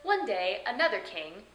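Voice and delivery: normal talking voice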